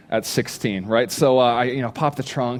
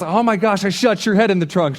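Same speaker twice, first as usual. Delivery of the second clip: horrified realization; 7 semitones higher, 5 dB louder than usual. Retold or imitated. Realizes he hurt his date.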